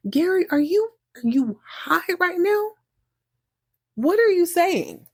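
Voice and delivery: baffled, high-pitched questioning; incredulous. Real, not acted. Host incredulous at Gary's retold line.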